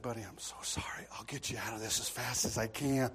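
hushed, urgent stage whisper; whispered apology. Retold or imitated. Officer secretly reassuring the humiliated boy, conspiratorial whisper. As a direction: whisper it low into the boy's ear. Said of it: "I went down and whispered".

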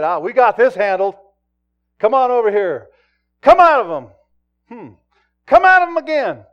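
loud, high, brash mock exorcism; cocky disciples. Disciples to demon, commanding it out in a loud, brash mock voice. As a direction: ham up the overconfident disciples shouting at the spirit.

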